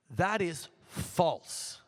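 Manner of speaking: very slow, each word set down firmly; firm rebuttal. Real, not acted. Prime Minister answering a heckler's claim, slow and firm. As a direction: plant every word of "That is false".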